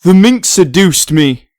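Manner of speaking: loud, slow indignant excuse; whiny protest. Acted. Ex-husband blames the sister, loud drawn-out protest. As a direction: plead it loudly and slowly.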